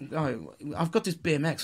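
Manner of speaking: mock posh child showing off his bike. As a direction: put on a light posh accent. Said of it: "we'll put a posh voice on".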